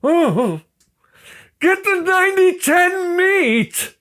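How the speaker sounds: very high, slow nagging wife voice; mock her in a shrill high voice. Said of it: "She's like".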